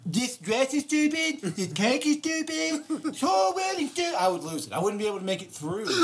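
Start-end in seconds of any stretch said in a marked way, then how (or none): 0.1-4.1 high, slow, over-acted voice; mock actor. Producer imagining actors auditioning, high hammy voice. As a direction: ham it up like a seasoned actor playing dumb. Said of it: "start saying".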